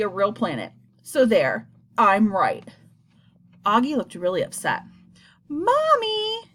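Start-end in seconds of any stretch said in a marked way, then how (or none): 5.5-6.5 put-on child's high yell calling for mom. A narrator's reading. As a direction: call out for mom like a little boy. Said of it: "he yelled out".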